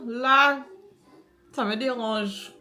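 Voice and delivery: speaking stiffly under a sheet mask; barely moving the lips under a face mask; through a face mask. Real, not acted. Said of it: "il faut que j'évite de parler".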